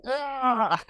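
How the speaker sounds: high mock yelp of delight; retold excited yell. Boss punching his rider, yelping with joy.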